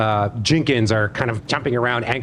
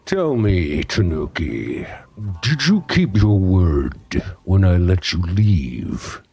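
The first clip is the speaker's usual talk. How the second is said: lower, slow, looming questions; lower, slow gremlin boss grilling Tanuki; menacing boss gremlin; 6 semitones lower than usual. Acted. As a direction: lean in low and slow. Bulging-eyed boss interrogates.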